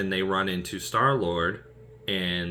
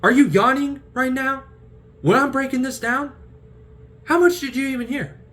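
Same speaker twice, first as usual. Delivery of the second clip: high, loud exasperated scolding; indignant Star-Lord; 8 dB louder than usual. Retold or imitated. Star-Lord annoyed at the yawning one, high and loud. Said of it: "he's just like".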